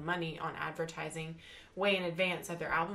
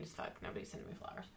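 muttered aside; 11 dB quieter, faster than usual. Real, not acted.

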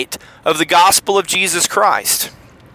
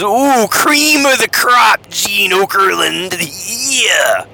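Macho Man Randy Savage; 3 semitones higher, much slower than usual. Retold or imitated. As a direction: drag it out like a wrestling promo. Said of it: "and he goes".

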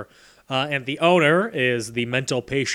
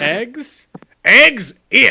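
louder, higher mock disgust at eggs; shopkeeper's disgust; 8 semitones higher, 10 dB louder, much slower than usual. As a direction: ham up the grossed-out shopkeeper. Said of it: "And then".

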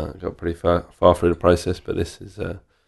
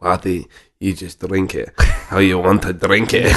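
slow, thick Scottish brogue, lightly done; a bar owner retelling a Scottish distiller, in a light Scottish accent; slower than usual. Retold or imitated. A lightly imitated Scottish accent, unhurried. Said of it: "With a thick Scottish accent".

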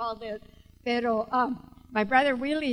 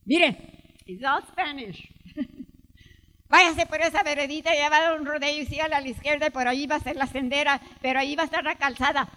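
fast local directions; 2 semitones higher, 7 dB louder, faster than usual. Retold or imitated. A local man rattles off directions to the lost visitor, fast. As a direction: rattle off directions at speed, louder. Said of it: "this is the way he talked".

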